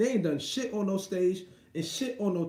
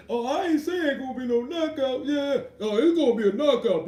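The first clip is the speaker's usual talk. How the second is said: high, louder mock commentator voice; 6 semitones higher, 5 dB louder than usual. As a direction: parody the pundit's empty prediction. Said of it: "Porter saying some".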